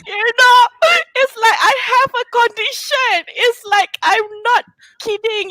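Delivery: fighting back real tears; real crying while explaining. Real, not acted. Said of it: "I am very emotional about this".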